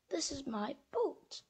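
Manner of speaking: soft unvoiced whisper. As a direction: whisper it gently. Mouse answers quietly.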